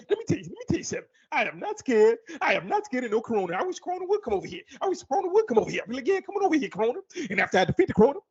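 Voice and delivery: high, fast, brash comic bravado; Kevin Hart impression. Kevin Hart boasting in a covid movie trailer, high and brash. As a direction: hype yourself up against the virus. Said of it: "I also do, uh, Kevin Hart".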